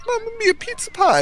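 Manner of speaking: very high, cartoony Italian cheer; jolly Italian pizza chef. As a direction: squeal it like a cartoon plumber. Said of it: "I get Mario. He'd be like".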